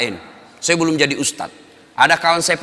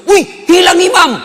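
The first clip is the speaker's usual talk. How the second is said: very high, loud, slow cry of alarm; startled shout; 12 dB louder than usual. Retold or imitated. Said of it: "Langsung teriak".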